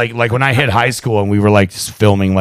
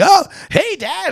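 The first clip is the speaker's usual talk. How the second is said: put-on high little-boy greeting; little kid voice; slower than usual. Dad imitating his son at the window, high kid voice. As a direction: chirp it like a small boy peeking out.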